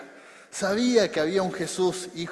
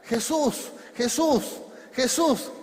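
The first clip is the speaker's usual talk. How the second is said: raised, higher calls on the name; repeated cries, pitched up and pushed; calling out; 6 semitones higher, 3 dB louder, slower than usual. Acted. Preacher as Bartimeo who began to shout to Jesus, calling out. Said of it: "Bartimeo empezó a gritar".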